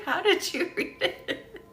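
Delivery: high, slow question through giggles; laughing. Real, not acted. Healer asking a viewer how they read it, laughing. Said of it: "that's killing me".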